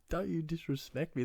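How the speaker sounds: high-pitched echo of his own yell, at normal volume; mock your own outraged line; self-mocking repeat. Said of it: "What, what is that?".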